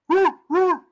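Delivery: high, loud cheering whoop; excited whoop. Real, not acted. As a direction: whoop it up like at a win. Cheering about the big dogs.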